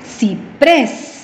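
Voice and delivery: "Ciprés" split, with PRÉS punched; stress on last syllable. Shown as a demonstration. Teacher gives another example, "Ciprés" stressed on PRÉS. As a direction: hit PRÉS in "Ciprés" after a light CI. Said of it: "la fuerza de voz en la última sílaba".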